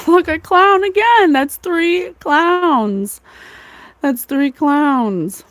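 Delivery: high-pitched, drawn-out delighted exclamation; amused amazement. Real, not acted. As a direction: laugh and marvel, stretching the words out. Laughing surprise at turning up clowns.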